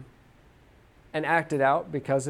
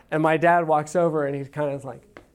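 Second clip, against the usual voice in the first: usual speaking voice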